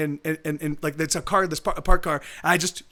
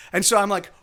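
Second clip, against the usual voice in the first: ordinary talk, nothing marked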